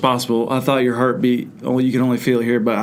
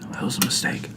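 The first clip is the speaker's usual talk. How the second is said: breathless whisper and sigh; whispered regret; 12 dB quieter, faster than usual. Real, not acted. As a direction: breathe it out, defeated. After the hot sauce.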